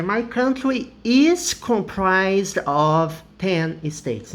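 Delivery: slow, careful English example; teacher reading a sentence slowly; slow model sentence. Shown as a demonstration. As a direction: read the example slowly for students. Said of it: "Ou eu poderia dizer".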